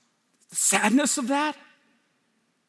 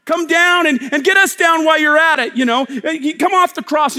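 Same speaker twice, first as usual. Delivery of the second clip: mocking shout; 4 semitones higher, 7 dB louder than usual. Mocking thief jeering loudly at Jesus on the cross. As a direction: jeer at him from the next cross. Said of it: "was mocking him and saying".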